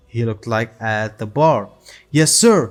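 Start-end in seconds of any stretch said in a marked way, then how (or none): 2.1-2.6 louder, higher acknowledgment; yelled reply. A narrator's reading. Bandits answer Higuma's order, yelling. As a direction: answer the boss with raised voice. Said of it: "the bandits yelled".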